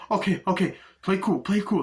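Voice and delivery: high, urgent self-calming; nervous urging. Retold or imitated. Driver as the cops approach, nervous urging.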